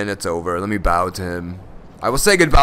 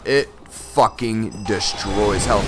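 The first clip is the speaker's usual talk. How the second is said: usual speaking voice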